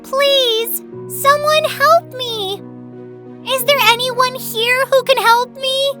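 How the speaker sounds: high, loud pleading cry; a small feather begging anyone to hear; desperate high-pitched plea, slowed on help. Acted. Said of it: "she cried".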